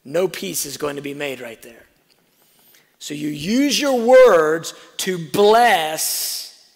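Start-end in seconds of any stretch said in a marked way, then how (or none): 3.0-6.7 loud, slow, drawn-out proclamation; preaching shout. Acted. Preacher to congregation, loud slow emphatic proclamation. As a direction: lean hard into every word.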